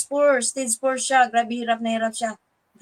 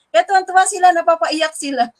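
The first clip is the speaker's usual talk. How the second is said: higher and louder, close to tears; excited, moved; 5 semitones higher, 8 dB louder than usual. Real, not acted. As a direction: lift it up, voice full of feeling. Recounting people's joyful tears. (masigla at halos maiyak)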